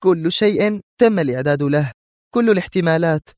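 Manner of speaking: high, angry insistence; high-voiced irritated rebuttal. A narrator's reading. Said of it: "قالت في غضب".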